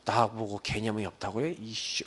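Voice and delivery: low, quiet indignant grumble under the breath; replaying the insult with a low, quiet huff; retold annoyance. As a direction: mutter it like a stung junior colleague. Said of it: "그러면서 막 이게 화가 나잖아요".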